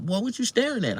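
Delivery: high voiced lady snapping at him; lady on the bus. The woman on the bus questions him, in a high mock voice. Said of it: "She's like".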